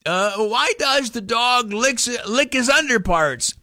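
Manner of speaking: put-on high, slow, innocent little kid; polite kid voice. Kid politely asking grandpa the riddle, high and innocent. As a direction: play dumb sweetly for grandpa. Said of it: "you're a polite person".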